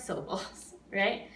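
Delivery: ordinary talk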